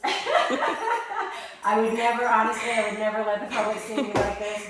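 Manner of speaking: embarrassed laughing confession to the camera; laughing through tears. Real, not acted. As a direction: let the laugh break the words open. Laughing after emotional testimony.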